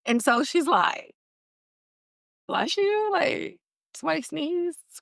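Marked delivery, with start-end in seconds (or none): 2.5-4.8 puzzled "bless you". Retold or imitated. Mock radio voice in the scene.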